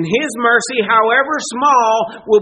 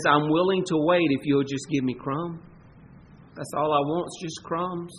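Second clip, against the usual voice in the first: softer, lower, meek; humble plea; 4 semitones lower, 7 dB quieter than usual. Pretend. The woman answering Jesus, soft and meek. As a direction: ask humbly, almost under breath.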